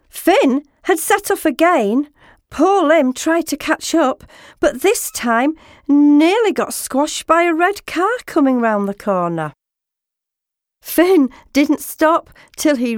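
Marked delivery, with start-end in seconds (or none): none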